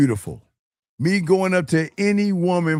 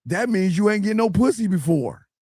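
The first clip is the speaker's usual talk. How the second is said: ordinary talk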